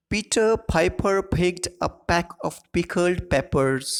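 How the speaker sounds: slow, careful tongue twister, punching each p. Shown as a demonstration. Teacher reading a tongue twister slowly for the class. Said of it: "ये है टंग twister".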